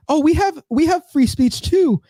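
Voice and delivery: high-pitched, drawn-out mock girl voice; imitated German girl. Girl debating free speech with Crowder, imitated high voice. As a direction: imitate her protesting in a high voice. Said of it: "some girl, I think she was from Germany".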